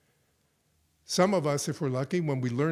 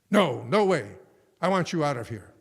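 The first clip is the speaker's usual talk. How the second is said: angry refusal; 7 dB louder than usual. Retold or imitated. Host refuses guest's plea.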